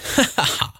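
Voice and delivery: high, merry acted chuckle; high rippling laugh in character; splendid laugh. A narrator's reading. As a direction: laugh out in giddy delight. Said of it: "it was a splendid laugh".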